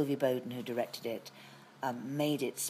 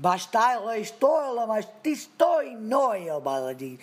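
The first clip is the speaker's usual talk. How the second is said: higher, louder fake Russian gibberish, slow at the end; mock Russian actor; 8 semitones higher, 8 dB louder, slower than usual. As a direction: ham up a grand Russian actor declaiming. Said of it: "Russian actor went".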